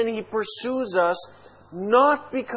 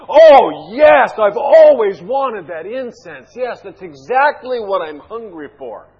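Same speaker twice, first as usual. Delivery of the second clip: loud, hammed-up fake delight; mocking God's delight; 11 dB louder than usual. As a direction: gush sarcastically, booming the opening words. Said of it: "you're making a mockery of this".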